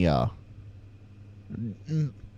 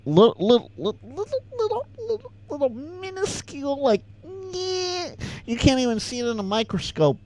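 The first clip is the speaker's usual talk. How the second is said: playful mock squeak, building from a bit higher to way up high; squeaky shrinking voice; 13 semitones higher, 5 dB quieter than usual. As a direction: pitch climbing to a tiny squeak.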